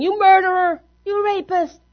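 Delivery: high-pitched accusing crowd yell; imitated angry yelling. Crowd outside a courtroom at the accused, mock angry yelling. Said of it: "they're yelling".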